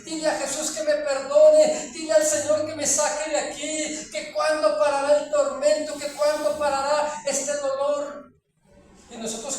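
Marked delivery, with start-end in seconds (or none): 0.1-8.3 loud, higher, begging cry; anguished pleading. Retold or imitated. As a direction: plead for mercy from the torment. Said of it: "ellos extendían su mano hacia nosotros y decía".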